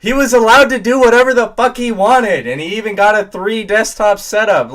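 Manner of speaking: high, loud furious rant; pissed off, voice cracking. Real, not acted. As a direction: yell it till the voice cracks. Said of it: "I'm literally voice cracking".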